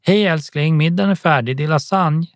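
lifted, sing-out call from a mum; mother calling out. A narrator's reading. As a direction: call your child in to dinner. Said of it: "mamma ropar".

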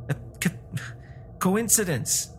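faltering start, then high-pitched; shaken stammer. A narrator's reading. Old man denying the wish worked, stammering. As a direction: stumble into the word nervously.

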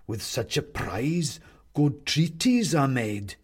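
drawn-out menace from a headless toy; the clown answers its captive, slow and eerie; sinister goblin clown. Acted. Clown character voice.

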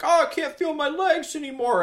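high, strained panicky voice; paralyzed audience member. Retold or imitated. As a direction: squeak it out as if going numb. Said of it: "You hear someone be like".

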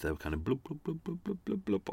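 soft gurgling blub, blub noises; bubbling sound imitation. Shown as a demonstration. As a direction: mimic water bubbling under the voice. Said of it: "the bubbling sounds underneath".

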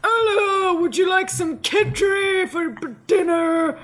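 high, exaggerated put-on voice; hammed-up stately impression; Margaret Thatcher. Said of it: "that is not what she sounded like".

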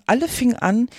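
usual speaking voice

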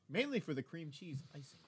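ordinary talk, nothing marked

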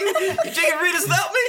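fast, high, laughing cat voice. Acted. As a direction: squeak it out as a betrayed cat. Voicing the cat accusing.

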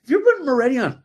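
high, fast, exasperated outburst at a friend; imitating his own indignant rant to a friend; outraged retort. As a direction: fire it back fast and high, like you can't believe it. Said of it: "And I was like".

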